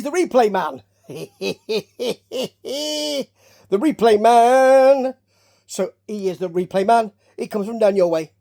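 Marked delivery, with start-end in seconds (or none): 3.7-5.1 loud, higher, slow announcement; drawn-out title. Pretend. Host playfully billing the replay man, drawn-out announcer call. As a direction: stretch MAN out long and loud. Mock title after plain take.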